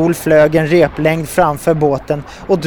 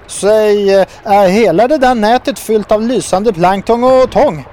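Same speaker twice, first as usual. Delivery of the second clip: louder, higher toad voice; eager question; 6 semitones higher, 5 dB louder than usual. A narrator's reading. Toad asking fishermen about the glowing net, eager. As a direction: ask with excited, raised pitch.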